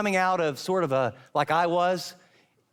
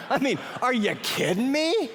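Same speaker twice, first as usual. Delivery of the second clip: incredulous; 6 semitones higher than usual. Retold or imitated. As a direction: sell his own disbelief. His own incredulous reaction.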